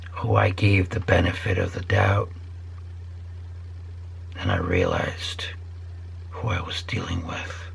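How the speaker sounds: sinks into a secretive whisper; hushed confession. Real, not acted. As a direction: tell it like a dark secret, barely voiced. Confiding bad experiences, dropping to whisper.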